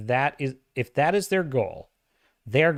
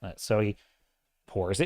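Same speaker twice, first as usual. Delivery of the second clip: usual speaking voice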